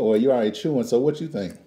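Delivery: ordinary delivery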